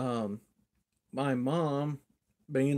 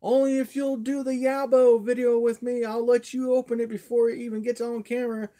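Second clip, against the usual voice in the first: high, loud holler to another room; re-enacted loud, high call; 9 semitones higher, 6 dB louder than usual. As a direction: holler the terms through the door. Hollering deal to mom through door.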